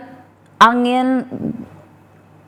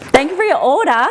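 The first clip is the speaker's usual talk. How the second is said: raised, high-pitched thanks; 6 semitones higher, 4 dB louder than usual. Real, not acted. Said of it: "my voice goes really high".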